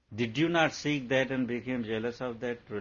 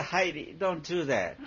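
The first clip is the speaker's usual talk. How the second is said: higher, laughing mock scold at Heidi; half-laughing, tell the dog off from the cushion; 5 semitones higher than usual. Owner half-laughing, telling her dog Heidi to stop barking. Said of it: "You say".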